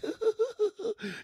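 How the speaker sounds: a sudden high-pitched burst of real laughter. Real, not acted. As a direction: cackle high at your own joke. Laughs at his own joke.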